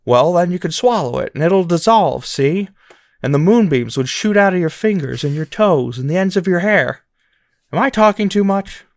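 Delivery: dreamy leading man, spinning a romantic fantasy; George Bailey impression. Host voicing George Bailey to Mary, with a hint of Connery. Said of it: "And George says".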